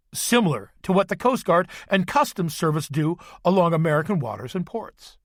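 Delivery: usual speaking voice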